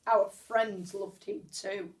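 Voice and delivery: plain reading voice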